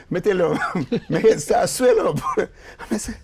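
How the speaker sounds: laughing through the words; real amused laughter over the words. Real, not acted. Laughing while telling reaction.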